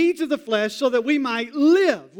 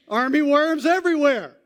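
shocked discovery; 5 semitones higher, 3 dB louder than usual. Retold or imitated. Discovering the infested yard.

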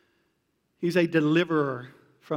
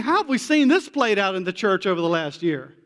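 ordinary delivery

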